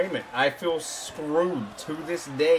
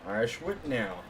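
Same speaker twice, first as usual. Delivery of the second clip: wrestling commentator; 4 semitones lower, 8 dB quieter than usual. Acted.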